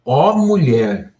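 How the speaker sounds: slow, drawn-out proclamation of wonder; amazed admiration. Retold or imitated. Jesus marvelling at the foreign woman's faith, amazed. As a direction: draw each phrase out, marvelling at her. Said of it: "Jesus ficou espantado, num bom sentido".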